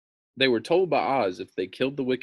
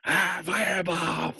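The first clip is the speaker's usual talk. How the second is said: mock fireball alarm; 8 semitones higher than usual. Mock alarm at screen effect.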